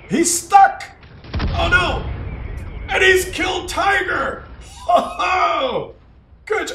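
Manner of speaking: loud, high, astonished shouts; excited exclaiming. Pretend. Commentator watches a tank get stuck, loud excitement. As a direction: cry out at the dramatic turn.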